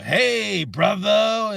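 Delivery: high, loud put-on "hey brother"; hammed-up buddy greeting; mock friendly greeting. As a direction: play the overly friendly stranger. Mimics a stranger's greeting.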